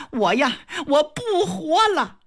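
high anguished shout; desperate maid crying she'll die; wailing cry. Acted. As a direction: wail it out in despair. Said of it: "嗷唠就喊了一句".

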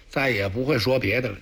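usual speaking voice

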